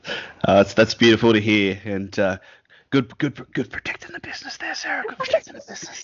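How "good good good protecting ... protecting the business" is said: stage whisper, all breath; teasing whisper. Acted. Host whispers teasing praise to his guest, a playful whisper. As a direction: whisper it like a sly aside to the guest.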